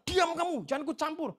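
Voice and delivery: shouted high and loud, imitating an angry stranger; angry man threatening the pastor, loud mock shout; mock furious shout. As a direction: bellow the threat like the man at the shop. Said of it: "Dia balik bilang".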